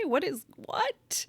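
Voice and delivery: slow, hushed mock bewilderment; breathy what-is-happening aside; puzzled whisper. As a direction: whisper it as if baffled. Mock confusion at her luck.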